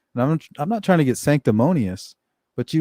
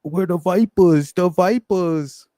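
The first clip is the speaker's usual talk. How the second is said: mocking mobster, higher and slow, drawn out; Tony Soprano; 7 semitones higher, 3 dB louder, slower than usual. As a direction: sneer the name slowly like a mob boss making fun. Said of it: "Moltisanti and says".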